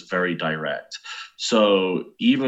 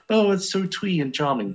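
much higher, lightly voiced gushing fan; mock gushing American; 11 semitones higher than usual. Host imitating Americans praising British films, light gushing voice. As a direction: gush like a charmed tourist. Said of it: "everyone's like".

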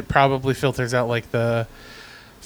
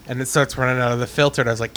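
ordinary delivery

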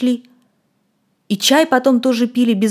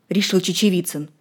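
plain reading voice

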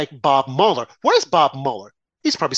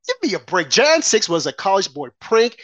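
normal talking voice

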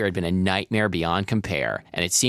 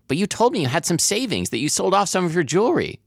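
hurt accusation; 11 semitones higher than usual. A narrator's reading. Daughter confronts mother in story.

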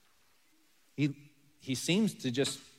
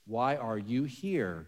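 soft and slow, much quieter; tender; 4 semitones lower, 7 dB quieter, much slower than usual. Shown as a demonstration. God quietly asks hurting Elijah, tender and slow. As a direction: ask it gently, like being right beside him.

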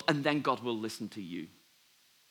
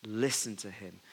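lower, softer, slow divine voice; a hint of God speaking from the cloud; lightly voiced God, solemn and hushed; 5 semitones lower, 5 dB quieter than usual. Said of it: "a voice from the cl-, cloud comes".

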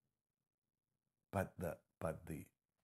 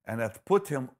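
louder and higher, building preacher fervour; fervent scripture reading; 3 semitones higher, 7 dB louder than usual. Acted. Preacher reading Ephesians to the congregation, proclaiming loudly. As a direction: proclaim the verse with mounting force.

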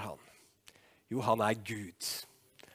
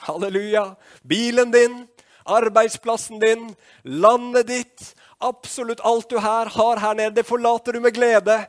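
high, loud, building pulpit voice; exultant preaching; 10 semitones higher, 11 dB louder than usual. Acted. Preacher telling of gladly leaving everything behind, exultant preaching. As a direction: ring out each item, building in force.

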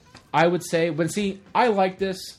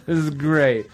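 put-on drunk party Tony; 6 dB louder, slower than usual. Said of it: "He's like".

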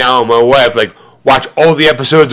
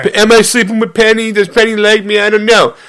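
lightly voiced insecure nerd; nerdy loser voice; 7 semitones higher than usual. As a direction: whine a little like a dateless geek. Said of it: "single nerds".

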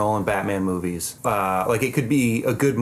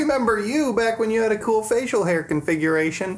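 high character voice; 11 semitones higher than usual. Retold or imitated. Retelling a trailer line, acted quote.

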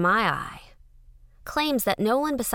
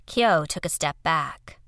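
ordinary delivery

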